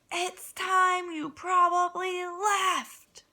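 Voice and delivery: high, loud, slow final words; cold dismissal. Acted. Angry pig telling Pickles to leave, slow loud. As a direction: spell out each word like a verdict. Pete sends Pickles away.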